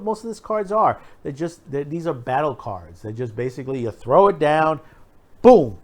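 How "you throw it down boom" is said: ordinary delivery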